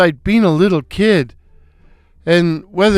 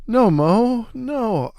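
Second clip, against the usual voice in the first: high protest; 5 semitones higher, 4 dB quieter, slower than usual. Acted. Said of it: "I protested".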